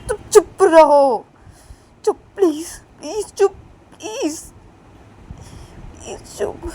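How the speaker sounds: loud, high pleading silence; frantic hush. Acted. Frantic hushing in quarrel.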